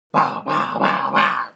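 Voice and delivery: imitated gun noises, bah bah; mock gunfire. Gamer imitating his gun firing at people. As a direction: make the sound of shooting with your mouth.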